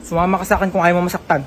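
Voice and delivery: low, quiet threat; menacing kidnapper. Acted. Kidnapper grabbing the kid, low threat. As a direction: lean in and menace.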